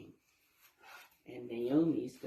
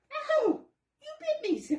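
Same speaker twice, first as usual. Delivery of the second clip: high, loud yelp of pain; cry of pain; 6 dB louder than usual. A narrator's reading. Walter bitten on the tail, loud yelp. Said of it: "cried Walter".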